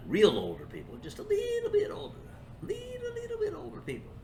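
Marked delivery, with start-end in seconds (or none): 1.0-2.1 put-on very high, light squeak on little bit older; squeaky high voice. Talking to himself, savoring it in a squeaky high voice. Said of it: "I like the way that sounds". 2.6-4.1 put-on very high, light squeak on little bit older; squeaky high voice. Talking to himself, savoring it in a squeaky high voice. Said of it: "I like the way that sounds".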